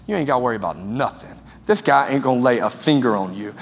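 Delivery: calm him down like a big protector; tough friend. Retold or imitated. Football friend reassuring Travis before the fight, low and calm.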